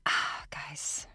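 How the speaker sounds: hushed, suspicious whisper. Real, not acted. Warning cohosts they're being played, in a whisper. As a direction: lean in and whisper your doubt.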